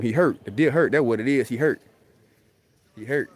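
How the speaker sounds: ordinary delivery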